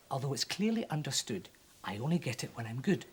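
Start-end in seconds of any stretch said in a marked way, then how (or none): none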